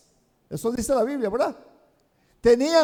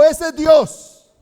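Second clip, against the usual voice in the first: drawn out, loud and higher; slow loud declaration; 4 semitones higher, 6 dB louder, much slower than usual. Acted. Elijah telling the crowd which God is real, slow loud declaration. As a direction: hammer each word slowly.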